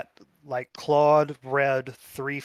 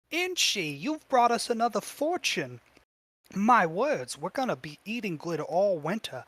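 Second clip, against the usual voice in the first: lilting, higher-pitched adoring mother; gushing doting mother; 4 semitones higher than usual. Acted. Goblin thanking Inchi for another fortune, gushing. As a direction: gush over the beloved child.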